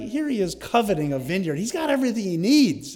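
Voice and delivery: ordinary talk, nothing marked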